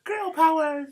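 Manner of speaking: put-on very high squeaky girl voice; squeaky high cheer.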